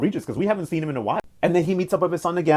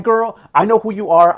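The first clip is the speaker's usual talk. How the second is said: higher and louder, scolding; telling her off; 6 semitones higher, 6 dB louder than usual. Retold or imitated. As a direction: open the scolding with a sharp jab. Grandma dragging granddaughter.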